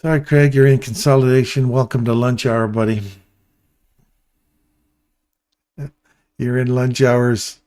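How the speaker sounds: booming, playful ribbing; loud teasing call-out. Acted. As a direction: call it out loud to tease a buddy. Teasing Craig about consolidation.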